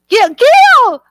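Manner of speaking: high, loud thrilled cry of Gail; host shouting the winning name with joy; excited. Real, not acted. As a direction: call the winner's name out in delight. Reading out the draw winner.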